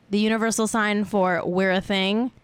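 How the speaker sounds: usual speaking voice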